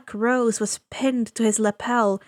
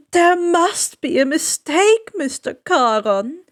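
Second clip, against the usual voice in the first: higher, louder mock protest; mock pleading dead; 5 semitones higher, 6 dB louder than usual. Ferryman mimicking the newly dead protesting, mock panic. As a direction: parody a panicked soul's whine. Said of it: "There's no screaming".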